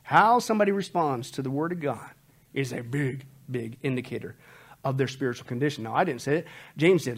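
normal talking voice

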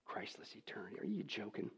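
breathy, scornful hush after the shouting; a sneering whisper. Real, not acted. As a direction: whisper it like a bad joke. Mocking the phrase in a whisper.

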